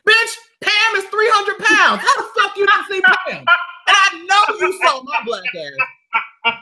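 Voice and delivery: high, loud, outraged disbelief; can't believe she missed Pam, loud; indignant disbelief. Retold or imitated. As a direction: friend retelling the line-cutter, loud disbelief. Indignant retort in story.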